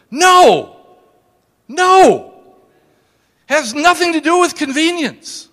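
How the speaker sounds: loud, high-pitched preacher's outburst; emphatic rebuttal. Real, not acted. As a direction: thunder it out to the congregation. Preacher forcefully rejecting the excuse.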